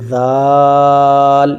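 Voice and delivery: ذال held very long with tongue tip on front teeth. Shown as a demonstration. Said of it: "اس type سے".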